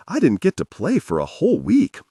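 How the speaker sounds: high, aggrieved complaint; indignant whine. Acted. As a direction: whine it like a wronged kid. Phil complaining about his kid.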